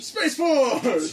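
high, loud mock hype; hyped announcer. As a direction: announce it like a big launch. Mocking the name, hyped.

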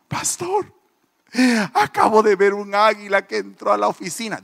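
high tearful wail, imitated; mock crying. Preacher imitating another crying sister reporting an eagle, tearful wail. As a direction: whimper the news like a sobbing woman. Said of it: "otra hermana llorando".

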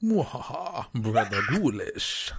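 slow evil villain intro with cackle; slow theatrical villain menace. Acted. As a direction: drawl the name like a horror host. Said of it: "I love everything about it".

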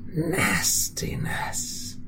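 a menacing storybook villain, slow and whispery at times; a creepy, theatrical villain narrator sliding into hushed whispers; sinister narrator. Acted. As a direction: taunt the listener like a scheming narrator. Villainous narrator taunts the listener.

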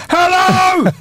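high-pitched mock club shout. Shouting in a girl's ear in a club, mock shout. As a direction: call out as if over club music. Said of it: "You gotta be shouting in a girl's ear".

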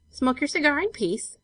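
ordinary delivery

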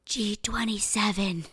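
breathy skull whisper. Retold or imitated. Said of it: "the skull just whispers".

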